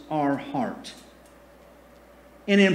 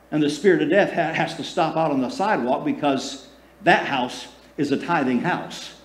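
ordinary talk, nothing marked

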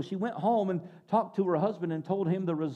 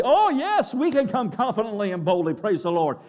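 loud, high jubilant burst; collective confidence; 5 semitones higher, 7 dB louder than usual. Acted. Congregation claiming the promise together, acted exuberant confidence. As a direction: shout it out like a whole church agreeing. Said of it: "where collectively".

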